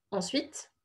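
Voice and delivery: ordinary talk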